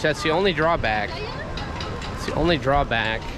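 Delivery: ordinary talk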